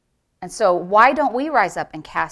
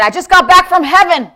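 high, loud reply breaking into laughter; exuberant shout; 8 semitones higher, 9 dB louder than usual. Retold or imitated. Norval answers his startled daughter, exuberantly loud. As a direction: burst out with it, amused.

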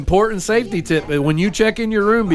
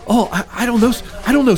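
flustered clerk; 5 semitones higher, faster than usual. Retold or imitated. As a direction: stammer like a caught-out clerk.

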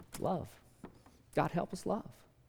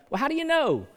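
much higher and louder question; loud challenge; 12 semitones higher, 9 dB louder, faster than usual. Real, not acted. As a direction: fling the question at the room. Rhetorical challenge.

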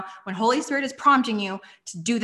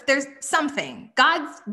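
ordinary delivery

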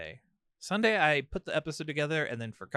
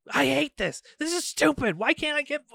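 put-on high, loud frustrated kid rant; angry kid outburst; 14 semitones higher, 7 dB louder than usual. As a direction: yell it like a sulky child stomping off. Son's tantrum voiced.

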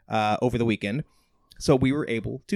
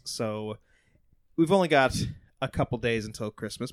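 ordinary talk

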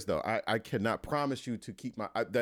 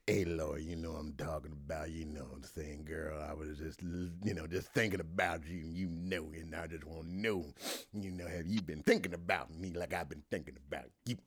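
low, soft, crooning voicemail; a slick old flame sliding into DMs; smooth ex-boyfriend voice; 5 semitones lower, 7 dB quieter than usual. Pretend. Host playing an ex leaving a low, soft voicemail. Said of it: "your voiceover characters are just absolutely hilarious".

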